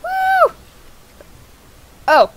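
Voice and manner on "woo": very high, loud "woo"; high whoop. Acted. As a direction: whoop at a falsetto pitch. Whoops during game ride.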